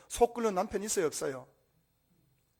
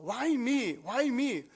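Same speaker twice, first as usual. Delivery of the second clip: higher-pitched wail of protest, without real weeping; acted outcry; 5 semitones higher, much slower than usual. Preacher as Hannah praying to God, mock anguished cry. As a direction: cry it upward as an acted plea. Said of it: "기도하고 통곡하면서".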